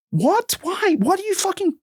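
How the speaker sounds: high-pitched, incredulous outburst; exasperated disbelief. Acted. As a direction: fire off baffled questions way up high. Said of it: "feel like I'm going insane".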